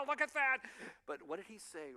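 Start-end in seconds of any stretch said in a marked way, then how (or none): 0.1-0.6 mock gloating God. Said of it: "Looking at the angels, going".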